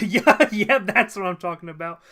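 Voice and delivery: pumped, laughing shout of approval; excited approval. Real, not acted. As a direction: cheer the chat on, laughing. Thrilled as chat responds.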